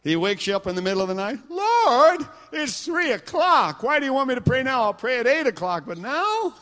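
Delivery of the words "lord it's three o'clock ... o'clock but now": loud, high complaining plea to God; whining protest. Retold or imitated. Preacher acting out his 3 a.m. complaint to God, whining. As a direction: whine at God about the hour, loud and high.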